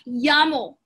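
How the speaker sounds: "llamo" said YAH-mo; double L as Y. Shown as a demonstration. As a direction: start "llamo" with a y glide, slowly. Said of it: "pronounced like Y".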